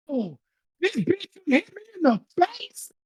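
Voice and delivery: very high, slow, soft squeal of disbelief; mock outraged disbelief. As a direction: imitate her indignation in a thin high voice. Retold reaction to being hit.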